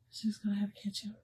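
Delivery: whispered aside. Real, not acted. Telling viewers about Michelle, in a whisper. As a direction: drop to a hushed whisper.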